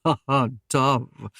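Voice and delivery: slow mock laugh into the words; a lightly voiced knight's chuckle; amused, slow half-laugh. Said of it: "Ragnar laughed".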